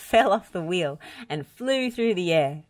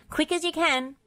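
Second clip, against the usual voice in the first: high, eager urging; 12 semitones higher than usual. Acted. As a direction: hurry them along. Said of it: "urged Bob".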